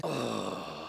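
a low, soft groan of disgust. Acted. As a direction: let out a low, quiet ugh of contempt. Disgusted at Showgirls fan.

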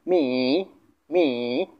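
"মি" held long as a lone syllable; pronunciation demo. Shown as a demonstration. Teacher showing learners how to pronounce it, drawn-out syllable. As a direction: draw out the vowel of "মি", pause between repeats. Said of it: "উচ্চারণ করবো কেমন করে".

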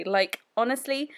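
normal talking voice

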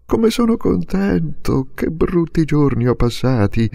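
stammered joy. A narrator's reading. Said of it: "balbettò".

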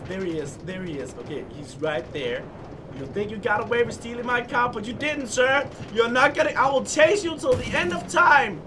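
normal talking voice

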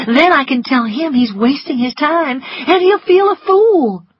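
triumphant, pitch climbing to the end; gloating. A narrator's reading. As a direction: relish showing him up. Triumphant imagined put-down of suitor.

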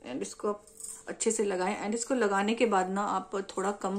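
mask covering lips and cheeks; talking with wet face mask; through a sheet mask. Real, not acted. Seen 0: Sheet mask covers whole face, mouth opening, throughout all frames.

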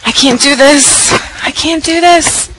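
rising slow panic. Acted. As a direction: plead with yourself. Panicking before the date.

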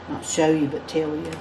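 ordinary delivery

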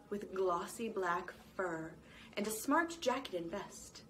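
ordinary delivery, nothing marked

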